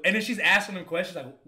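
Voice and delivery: usual speaking voice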